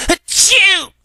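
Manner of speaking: breathy build, then a loud high sneeze; big sneeze. A narrator's reading. Spike sneezing on the books, loud sneeze. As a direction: gasp in, then sneeze hard.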